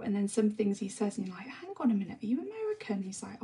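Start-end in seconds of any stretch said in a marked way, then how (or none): 1.5-3.0 high, rising mock-incredulous question; puzzled surprise. Retelling her reaction to the fitter's mixed accent, puzzled surprise. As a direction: voice climbs sharply as if suddenly catching on.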